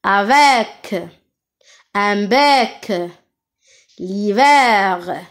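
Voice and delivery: slow, long è in "Avec", "bec", "hiver"; open è. Shown as a demonstration. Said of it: "je prononce E comme la mer". (e prononcé è ouvert dans « bec »)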